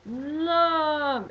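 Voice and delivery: yelling love. Retold or imitated. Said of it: "I'm seriously yelling the word".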